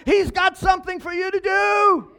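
high, loud preaching shout; full-force preacher's cry; shouted climax. Real, not acted. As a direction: hit the peak of the sermon. Preacher climaxes his point.

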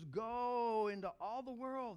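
loud, high, slow proclamation; preaching at full force. Acted. Pastor proclaiming to the congregation, loud and slow. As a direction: belt out Jesus' words to the church.

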